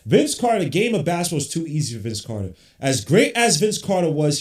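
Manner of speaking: loud impassioned rant; heated and loud. Real, not acted. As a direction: belt it out, worked up. Heated rant about player.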